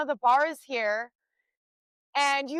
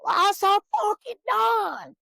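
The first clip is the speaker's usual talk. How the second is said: loud, slow yell much higher, with a little twang; manly yell with a twang; 8 semitones higher, 4 dB louder, slower than usual. Shown as a demonstration. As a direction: redo the yell, fed up and done. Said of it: "I sound like a fucking man".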